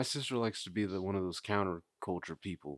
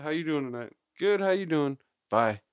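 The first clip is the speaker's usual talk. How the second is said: mock small talk; 7 semitones higher, 4 dB louder than usual. Acted doorstep small talk.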